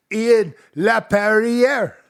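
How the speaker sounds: high, drawn-out French-Canadian "Ian Laperriere"; French pronunciation. Shown as a demonstration. French player name said French way.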